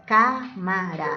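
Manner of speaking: "Cámara" slowly as cá, ma, ra; syllable by syllable. Shown as a demonstration. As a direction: split "Cámara" into three drawn-out syllables. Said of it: "Silabeamos, tres sílabas". ("Cámara" silabeado despacio: cá, ma, ra)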